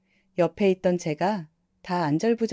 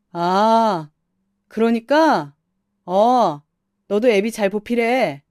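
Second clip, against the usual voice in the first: louder, smug husband; gloating husband; 6 dB louder, much slower than usual. Acted. Husband gloating at his wife after his mother's praise, louder and smug. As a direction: crow over her, smug.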